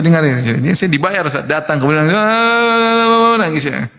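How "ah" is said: a paid mourner's theatrical cry; fake crying wail. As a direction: fake a sudden sob of grief on cue. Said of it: "Drama nangisnya".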